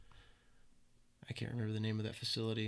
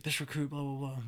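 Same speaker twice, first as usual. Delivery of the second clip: lightly imitated recruit reciting, raised a little in pitch; recruit talk; 3 semitones higher than usual. Recruit addressing the drill instructor, in mock recruit talk. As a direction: mimic the formal way recruits must talk. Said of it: "we all have to talk a certain way".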